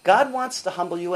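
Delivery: normal talking voice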